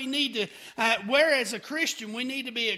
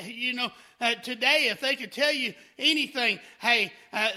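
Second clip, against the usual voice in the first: usual speaking voice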